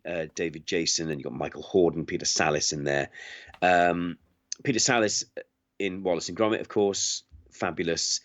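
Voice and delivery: ordinary delivery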